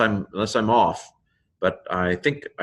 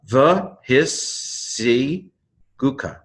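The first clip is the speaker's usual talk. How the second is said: slow, higher "the his si gu ka" in held pieces; syllable by syllable; 6 semitones higher, much slower than usual. Shown as a demonstration. Teacher retrying a tricky Hebrew word, syllable by syllable. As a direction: stretch out each syllable. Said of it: "ends the first syllable".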